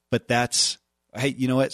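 ordinary delivery

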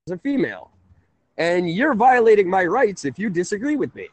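ordinary talk, nothing marked

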